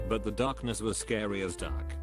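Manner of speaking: ordinary delivery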